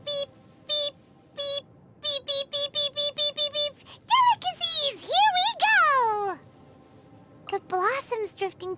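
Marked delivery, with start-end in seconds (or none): 0.0-3.8 mock radar beeps, slightly high; radar beeping. Paimon playing a food radar, beeping. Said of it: "Paimon radar activated". 4.1-6.4 excited. Acted. Excited dash toward food.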